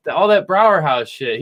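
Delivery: ordinary talk, nothing marked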